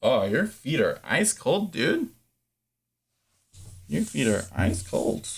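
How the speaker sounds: tender, quiet words about cold feet. Real, not acted. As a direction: murmur gently to the little one. Soft words to the baby.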